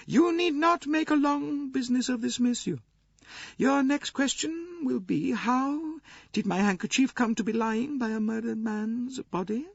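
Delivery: very high grande dame voice; imperious old princess, brisk authority. A narrator's reading. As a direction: take charge as an indomitable old princess.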